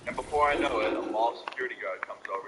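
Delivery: ordinary delivery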